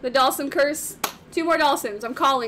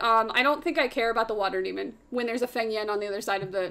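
ordinary talk

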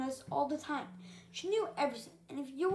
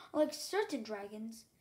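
usual speaking voice

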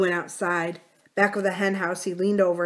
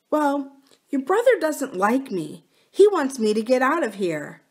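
higher, plaintive complaint; lightly voiced wronged wife; 7 semitones higher, 4 dB louder than usual. Wife complaining to husband about his brother, lightly plaintive. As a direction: confide the grievance, pitched up.

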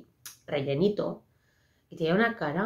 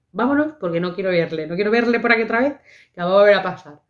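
loud, higher pleading through tears; retold tearful outburst; 3 semitones higher, 7 dB louder than usual. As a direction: beg to leave while sobbing. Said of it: "y yo empecé ahí a llorar".

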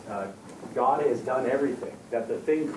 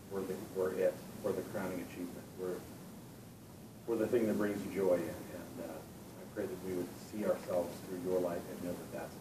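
soft, hushed prayer voice, growing softer; opening prayer; 4 semitones lower, 5 dB quieter than usual. Acted. Preacher praying softly over the youth group. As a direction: pray quietly and earnestly to God.

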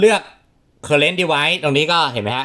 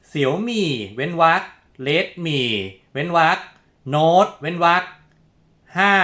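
slow, higher-pitched dictation with clear pauses; dictating word by word; 5 semitones higher, much slower than usual. Shown as a demonstration. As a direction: spell out each part, pausing after every chunk. Dictating a full name to type. (พูดบอกให้พิมพ์ทีละคำ ช้าๆ เว้นจังหวะ)

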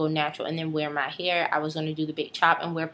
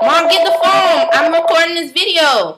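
much higher, much louder shout; yelling to mom; 13 semitones higher, 16 dB louder than usual. Real, not acted. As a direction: yell across the house for mom. Yelling to mom while recording.

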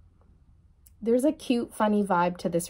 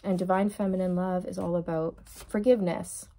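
ordinary talk

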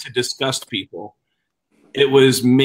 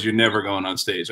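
deadpan refusal; 6 semitones lower, 5 dB quieter than usual. Retold or imitated. Club friend refusing him.

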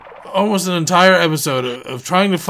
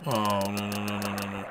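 low, soft string of no's; sinking, quiet dismay; 8 semitones lower, 11 dB quieter than usual. Real, not acted. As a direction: groan it out in low resignation. Dismay at another rock.

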